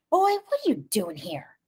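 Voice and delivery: hissed challenge, pitched high. A narrator's reading. Cassie confronts T J outside at night, hissing. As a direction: confront an intruder in a high hiss. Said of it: "I hissed".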